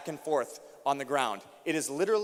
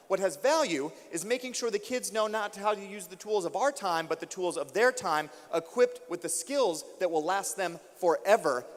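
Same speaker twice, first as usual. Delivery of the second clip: higher, rousing speech to the hall; impassioned keynote preaching; 5 semitones higher than usual. Acted. Speaker rallying a room of teachers, fervent and rising.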